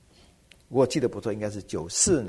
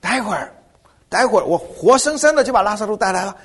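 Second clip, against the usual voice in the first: a preacher playing Jesus shushing the weepers, getting more worked up; hushing the mourners; 8 semitones higher, 6 dB louder than usual. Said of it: "我一定会怎么说？".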